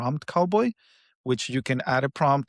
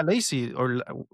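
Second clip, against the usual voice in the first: ordinary talk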